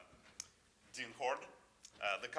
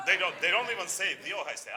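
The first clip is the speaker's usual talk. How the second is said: louder and higher, pressing the point; emphatic, raised voice; 8 semitones higher, 9 dB louder than usual. Real, not acted. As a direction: build it up with force. Excited point about Ohio State.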